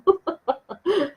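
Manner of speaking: short, high chuckle; a quick amused burst of laughter. Real, not acted. Amused by her own remark. (risadinha aguda e curta)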